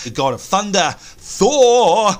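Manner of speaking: mock-epic introduction, swelling loud on the name; grand announcement. Host presenting his co-player as Thor, mock-grand announcement. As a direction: announce a hero, booming out the last word.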